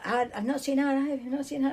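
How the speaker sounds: high-pitched retold panic; frantic. Worker searching for missing Ivy, frantic. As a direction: voice climbing as you go berserk. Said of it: "I'm going berserk".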